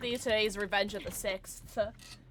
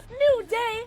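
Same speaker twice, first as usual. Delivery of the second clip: excited cheer; 13 semitones higher, 11 dB louder than usual. Real, not acted. Cheering in the lobby.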